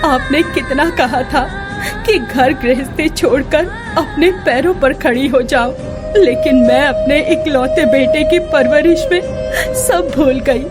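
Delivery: crying heartbroken to a late husband; weeping. Acted. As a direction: sob through the words in despair. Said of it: "फूट फूट कर रोने लगी".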